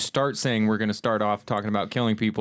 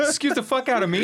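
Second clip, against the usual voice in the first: indignant; 14 semitones higher than usual. Retold or imitated. Acted indignant quote.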